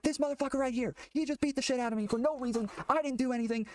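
high, furious yelled accusation; screaming and yelling. Retold or imitated. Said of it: "screaming and yelling".

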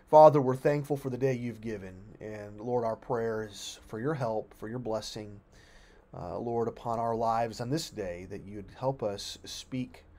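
low, soft praying voice; prayer. Real, not acted. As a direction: speak humbly to God, quietly and haltingly. Leads closing prayer.

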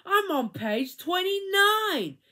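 high, loud and slow jeer; taunting child. A narrator's reading. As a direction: crow it out like a bratty girl. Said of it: "said Joy".

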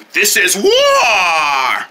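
very slow, drawn-out war shout; battle cry. Acted. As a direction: stretch the last word into a long roar. Villain declares war.

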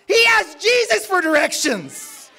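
high, loud preaching punchline; emphatic shout. Real, not acted. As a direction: shout it out slowly to the whole church.

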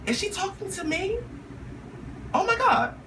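high, hushed disbelief; breathless surprise. Retold or imitated. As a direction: gasp it out. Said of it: "at first I was like".

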